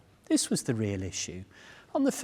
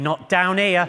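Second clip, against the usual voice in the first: a raised, louder, protesting stomach voice; indignant stomach voice, raised and loud; stomach talking back; 6 semitones higher, 7 dB louder than usual. Retold or imitated. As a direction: let the stomach refuse, half-laughing. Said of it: "my stomach was going".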